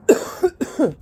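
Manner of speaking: short smoker's cough; hacking cough; harsh cough. Real, not acted. Coughing after hit.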